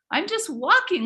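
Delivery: mock-indignant I'm just walking; insistent repeat. Repeats reply more insistently.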